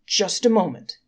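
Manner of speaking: accusing angel mildly calls out to the court; calling out. Acted. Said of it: "called out the accusing angel".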